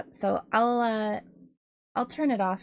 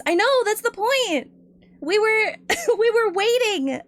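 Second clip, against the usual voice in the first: high, loud exasperated laughing complaint; laughing protest; 11 semitones higher, 6 dB louder than usual. Real, not acted. Streamer protesting to the mod, high laughing exasperation.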